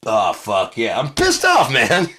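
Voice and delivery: high, loud, laughing put-upon voice; laughing imitation. Bobby's neighbor complaining about the lawnmower, laughing. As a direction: whine it high through laughter. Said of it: "He came like".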